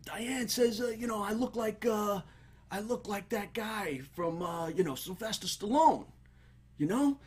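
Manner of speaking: high, hesitant hopeful voice; fishing for a compliment, unsure; insecure guy fishing. Retold or imitated. Guy nervously asking dad to confirm Stallone look. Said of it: "he goes".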